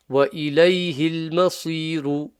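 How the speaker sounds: وَإِلَيْهِ الْمَصِيرُ recited with a heavy sad; full-mouth sad. Shown as a demonstration. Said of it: "Sad is full mouth".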